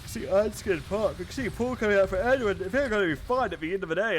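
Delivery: talking through a yawn. Real, not acted. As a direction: yawn while speaking. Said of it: "I'm yawning while I'm speaking".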